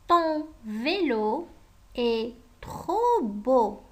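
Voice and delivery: very slow, word-by-word dictation; measured classroom dictation pace. Acted. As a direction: spell it out slowly so pupils can write. Teacher dictating model sentences.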